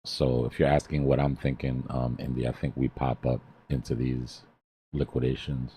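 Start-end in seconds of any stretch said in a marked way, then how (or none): none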